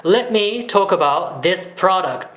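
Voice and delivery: very slow, careful English demo; teacher modelling slowly; slow model phrase. Shown as a demonstration. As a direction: spell it out word by word. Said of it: "ご説明させてくださいっていう場合も".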